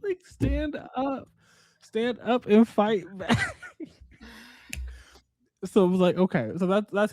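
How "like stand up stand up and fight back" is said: high, slow drawn-out plea to her; mock pleading. A book reader urging the heroine, high and slow. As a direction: beg her slowly in a much higher voice. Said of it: "I was just like".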